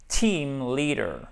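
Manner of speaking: "team leader" slow, with both ee vowels long; "team leader" as TEEEM LEEE-der, falling at the end; long I sounds. Shown as a demonstration. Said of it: "Là, vous avez un i long".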